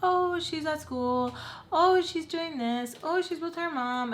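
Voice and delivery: high, slow singsong mock excuses; mimicking evasive relatives; mocking their excuses. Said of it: "They're just like".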